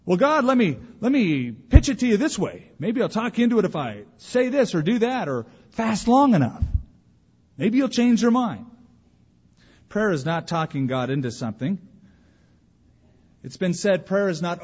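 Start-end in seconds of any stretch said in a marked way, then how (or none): 0.0-8.7 high, loud pushy sales pitch to God; high, loud mock salesman pleading with God. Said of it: "give God a sales pitch".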